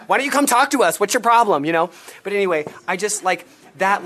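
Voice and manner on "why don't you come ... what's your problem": loud, higher pushy challenge; menacing demand. Retold or imitated. Missionary pressing the woman hiding under her table, loud and pushy. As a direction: demand an answer, needling and loud. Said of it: "just being so menacing and being like".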